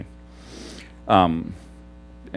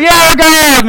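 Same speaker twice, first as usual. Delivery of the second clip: imitated loud, high cheer; excited shout; 10 dB louder than usual. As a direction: mimic an excited worshipper yelling. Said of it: "they would get excited".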